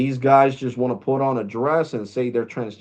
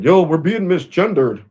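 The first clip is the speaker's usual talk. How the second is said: mock complaint; 6 semitones higher, 7 dB louder than usual. Mocking people complaining online.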